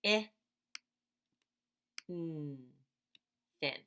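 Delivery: "I, n" as single sounds, then "thin"; sounding out. Shown as a demonstration. Teacher segmenting thin for children. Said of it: "So I hear".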